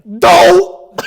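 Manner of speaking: loud, high-pitched cartoon yelp of dismay; Homer. Shown as a demonstration. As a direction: blurt one exasperated cartoon cry. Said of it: "That's my Homer impression".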